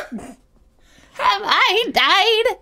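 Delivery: very slow, high, drawn-out ghost wail; hammed-up ghost. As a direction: moan it like a cartoon ghost. Ghost line replayed, hammed.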